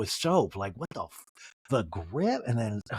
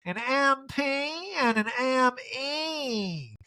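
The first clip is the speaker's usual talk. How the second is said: slow, drawn-out mock Erica voice, pitched up; 9 semitones higher, much slower than usual. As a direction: drawl it out like a haughty housewife.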